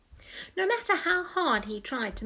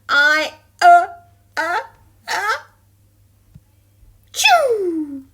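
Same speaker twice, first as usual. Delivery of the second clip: building into a sneeze; 9 semitones higher, 14 dB louder, slower than usual. Acted.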